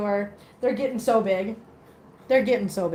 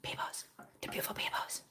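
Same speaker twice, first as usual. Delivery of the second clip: hushed whisper to a pet; 19 dB quieter than usual. Real, not acted. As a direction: whisper it softly. Whispering to the bird.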